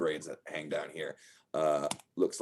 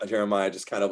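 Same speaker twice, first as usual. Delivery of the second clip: ordinary talk, nothing marked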